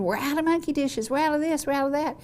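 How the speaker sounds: high mock yell about dishes; calling out over the rush, pitched high; retold screaming. Owner calling to the kitchen, high mock yell. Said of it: "And I would be screaming".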